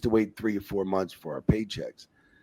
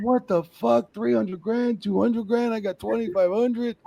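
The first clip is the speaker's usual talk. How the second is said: high-pitched retold protest; incredulous outrage; 13 semitones higher, slower than usual. Referee comparing his pay with wrestlers' checks, incredulous outrage. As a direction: act out disbelief at unfair pay.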